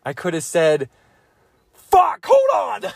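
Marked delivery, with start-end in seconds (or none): 1.7-2.9 high, loud burst of frustration; flustered outburst. Real, not acted. Put on the spot to name a woman, flustered yelp. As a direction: yelp it while stalling for an answer. Stuck trying to name older women.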